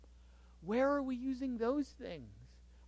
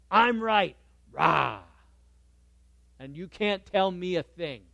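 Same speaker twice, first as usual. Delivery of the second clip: mock stubborn shout; 3 semitones lower, 5 dB louder than usual. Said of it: "people on each side going".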